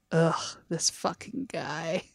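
quiet, fed-up mutter; fed-up groan. Retold or imitated. Band members losing patience with interviewer, quietly fed up. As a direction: imitate band members giving up on him. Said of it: "see them all just kind of go".

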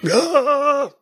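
high-pitched cheering bystander; crowd heckler. Acted. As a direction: shout approval from the crowd. GM voices a cheering crowd member.

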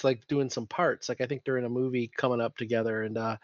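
normal talking voice

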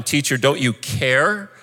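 pleading outcry climbing from near-normal to very high pitch; acted shouting. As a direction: build into a desperate, high-pitched protest, acting the disciples. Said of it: "The disciples woke him up shouting".

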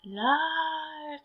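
very soft, breathy ghost calling his name; ghostly whisper. Retold or imitated. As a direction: call him from the dark, barely voiced. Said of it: "whisper his name".

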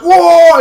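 very high, loud laughing whoa; laughing whoa yell. Acted. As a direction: shout it through laughter. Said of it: "their one's like".

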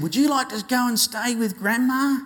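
high, lilting mum voice; mum's inviting voice. Retold or imitated. Mum asks ten-year-old to stay with Grandma, high and inviting. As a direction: pitch it up like a mum offering a treat.

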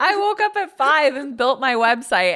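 high, loud, thrilled retelling; excited. Retold or imitated. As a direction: burst out with the news. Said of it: "being like".

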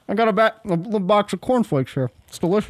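chewing dry cereal while talking; mouth full. Real, not acted. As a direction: talk through crunchy cornflakes. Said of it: "I'm eating cornflakes".

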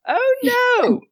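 hammed-up falsetto dismay; very high, theatrical wail; intimidated old lady. Gatekeeper lady threatened by travellers, hammed-up falsetto dismay. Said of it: "this lady, is gonna say".

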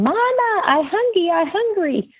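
put-on high-pitched toddler calling for mama; two-year-old voice. Toddler waking from nap to her mother, child voice. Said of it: "in her two-year-old voice".

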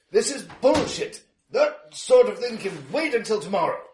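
loud, high, indignant bluster; John Washington. Acted. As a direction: protest like an outraged pundit. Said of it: "You hear the rumbling voice of online commentator".

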